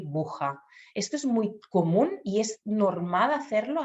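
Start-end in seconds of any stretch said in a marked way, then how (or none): 0.0-0.6 buja with the r missing from bruja; child's mispronunciation. Retold or imitated. Child saying bruja as buja. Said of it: "decir buja".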